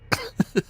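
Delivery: high, cackling laugh; unhinged laugh. Acted. Prisoner laughing over the failed attempt, unhinged. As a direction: break into a high giggle.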